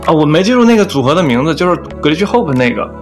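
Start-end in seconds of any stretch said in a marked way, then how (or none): none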